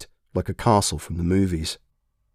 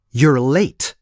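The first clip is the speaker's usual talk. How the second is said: louder, higher snap of a greeting; sharp call-out; 5 semitones higher, 5 dB louder than usual. A narrator's reading. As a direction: catch him off guard. Woman surprises him at booth.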